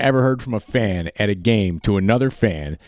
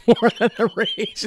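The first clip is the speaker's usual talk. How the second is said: laughing; 11 semitones higher than usual. Real, not acted. Laughing at salary comparison.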